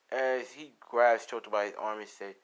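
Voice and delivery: ordinary delivery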